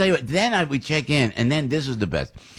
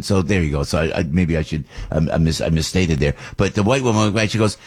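ordinary talk

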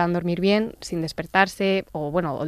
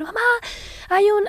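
retold child's scream; 13 semitones higher than usual. Mother acts out her son's night terror, screaming. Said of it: "se puso a gritar".

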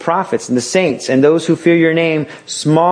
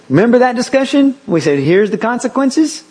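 higher, leading parent voice; walk the child back to the warning; parent reminding; 9 semitones higher than usual. Retold or imitated. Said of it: "go back and say".

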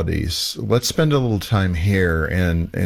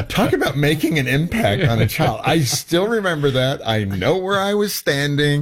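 higher-pitched, amused through laughter; laughing; 7 semitones higher than usual. Real, not acted. Host recalls a remark that stuck since childhood, laughing.

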